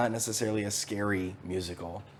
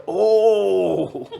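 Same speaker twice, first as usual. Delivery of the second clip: startled loud oh; shocked as the chair breaks; surprised gasp; 8 semitones higher, 10 dB louder than usual. Retold or imitated.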